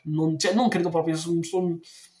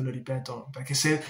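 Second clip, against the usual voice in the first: usual speaking voice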